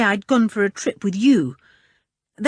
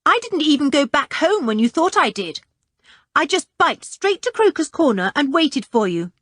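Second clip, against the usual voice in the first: put-on higher, cocky boyish boasting; bravado; 9 semitones higher than usual. A narrator's reading. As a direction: brag a little, pleased with himself. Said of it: "with a great air of bravado".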